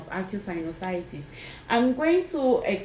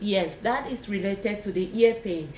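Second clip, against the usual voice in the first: normal talking voice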